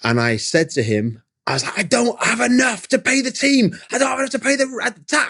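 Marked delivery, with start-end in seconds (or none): 1.8-5.3 high, strained furious rant; ranting at God. Retold or imitated. To God at dawn while feeding the dog, high furious rant. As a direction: vent the anger at God, voice up high. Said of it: "I'm furious".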